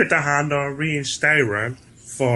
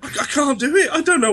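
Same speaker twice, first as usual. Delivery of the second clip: laughing refusal; 10 semitones higher, faster than usual. Real, not acted.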